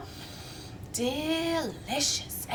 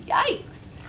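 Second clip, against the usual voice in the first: yikes; 11 semitones higher than usual. A narrator's reading. Narrator's reaction.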